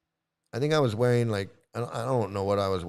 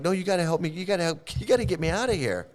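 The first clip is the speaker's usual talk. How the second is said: higher-pitched pleading, tumbling repeats; retold panic; 8 semitones higher, faster than usual. Begging a friend to pull them from mud, lightly panicked. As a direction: re-enact a mild panicky plea for help. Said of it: "and I'm freaking out".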